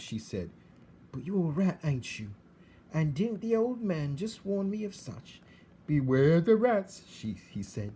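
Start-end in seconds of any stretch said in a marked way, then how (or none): 1.1-7.0 put-on high, loud, rough country-girl voice; a plain, brash servant girl, wide-eyed; rough serving girl.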